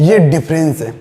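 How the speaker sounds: ordinary talk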